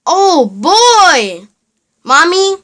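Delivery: can't wait to go play; thrilled. Acted. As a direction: Elmo thrilled at the first snow. Elmo thrilled by snow.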